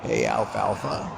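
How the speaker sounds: a light, low Froggy croak calling out; a hint of Little Rascals Froggy; Froggy impression. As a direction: greet Alfalfa in a lightly done Froggy voice. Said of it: "I sound like Froggy from the Little Rascals".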